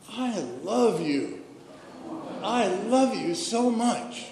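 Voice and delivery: put-on adoring little girl. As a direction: imitate a little girl gushing with love. Little girl adoringly addresses the cashier.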